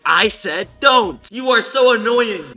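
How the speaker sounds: loud, slow, exasperated retort; mock anger. Reading out the annoyed rival's message, mock anger. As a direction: voice it loudly as a fed-up rival. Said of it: "I'm just gonna make fun of him".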